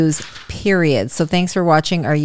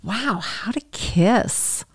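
slow whispered tease; teasing whisper; 2 semitones higher, 5 dB quieter, much slower than usual. Real, not acted. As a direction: breathe it out slowly. Mother teasing her daughter.

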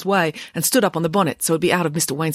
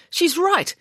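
high-pitched amazed admission; astonished realisation; 14 semitones higher than usual. A narrator's reading. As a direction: concede it in sudden amazement. Headmaster amazed by the proof.

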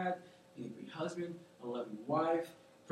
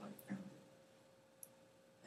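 slow, whispered, much softer; whispered ending; 8 semitones lower, 22 dB quieter, much slower than usual. Retold or imitated. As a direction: breathe the last words. Quote trails into a whisper.

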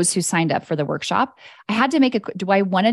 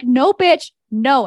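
sharp, louder, higher no to herself; mock scolding herself; 7 semitones higher, 5 dB louder, slower than usual. Scolding herself for getting attached, mock sharp and loud. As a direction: snap at yourself half-jokingly.

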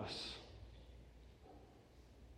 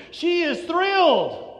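loud, high, drawn-out exclamation; emphatic excitement; 8 semitones higher, 5 dB louder, slower than usual. Real, not acted. Preacher urging listeners to feel the emotion, thrilled exclamation.

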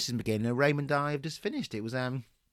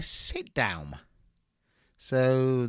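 usual speaking voice

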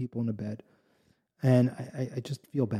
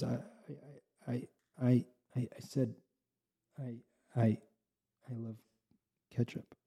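nervous stammer; 11 dB quieter than usual. Acted. Innkeeper answers the rebbe nervously, stammering and soft. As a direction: stumble over the words as if caught out. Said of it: "he knows he did something wrong".

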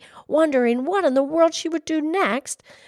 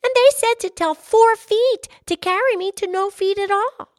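put-on high, loud, insistent little girl; crying out; 7 semitones higher, 5 dB louder than usual. A narrator's reading. Girl passing on the little folk's message, crying out. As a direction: plead the case in a raised child's voice. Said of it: "she cried".

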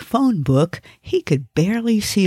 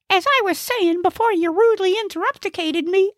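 very high, put-out comic voice; indignant Gnesi; faster than usual. Acted. Gnesi defends himself to the old lady, high and indignant. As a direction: protest in a high-pitched character voice. Interrupted character complains.

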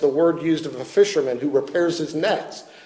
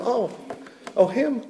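mock surprise; 7 semitones higher, 7 dB quieter than usual. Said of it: "the deacon said to him".

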